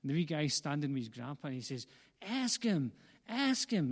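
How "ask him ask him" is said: put-on high, punchy American kid's urging; hammed-up American accent, pitched up; attempted American accent. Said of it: "That's my best American accent".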